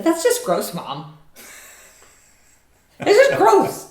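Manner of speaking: high, loud disgusted whine; grossed-out teen. Retold or imitated. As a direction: whine it like a grossed-out teenager to his mom. Nephew disgusted reply to mom.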